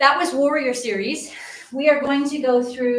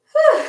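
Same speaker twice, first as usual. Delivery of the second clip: high breathy whew; 8 dB louder than usual. Real, not acted. Exhale after standing up.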